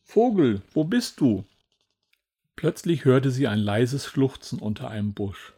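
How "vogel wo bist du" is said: raised, louder searching call. A narrator's reading. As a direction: call into the distance for a lost friend. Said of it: "rief sie". (laut suchendes Rufen)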